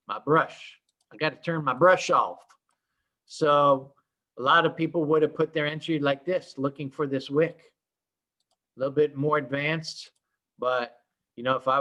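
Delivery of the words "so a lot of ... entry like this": ordinary talk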